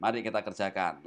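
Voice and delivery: ordinary talk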